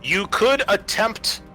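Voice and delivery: usual speaking voice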